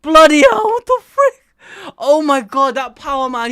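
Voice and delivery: high, loud, stunned outburst at the panel; shocked excitement. Real, not acted. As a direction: blurt it out in real disbelief, almost crying out. Shocked reaction to a manga panel.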